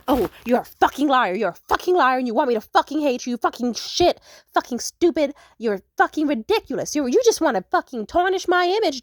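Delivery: loud furious yelling; screaming match. Retold or imitated. Couple screaming insults over the phone, furious yelling. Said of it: "screaming in the phone at each other".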